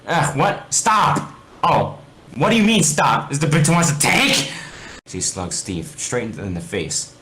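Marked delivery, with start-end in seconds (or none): 0.1-1.3 high, loud panicked stop; pushed off under attack; alarmed protest. Acted. Steve attacked by dog. 1.6-4.5 high, loud furious scolding; angry retort. Acted. Alex furious at Steve.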